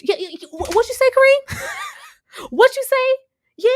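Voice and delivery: high, loud taunt, slowing at the end; mock scolding yell. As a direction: call Kareem out in front of everyone. Coach scolding Kareem loudly.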